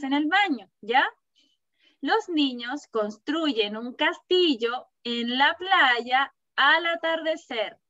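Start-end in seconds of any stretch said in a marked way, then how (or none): 2.0-7.8 put-on slow, careful dictation for a child; slow read-aloud. Shown as a demonstration. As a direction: read each word out slowly for the class. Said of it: "te voy a leer la oración".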